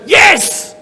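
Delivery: loud, high cheer; shout yes. Shown as a demonstration. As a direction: shout it out joyfully. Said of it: "You gotta go".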